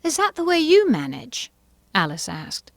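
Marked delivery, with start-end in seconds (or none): 0.0-1.5 high, excitable Mad Hatter; fussy, animated gentleman. A narrator's reading. Character voices.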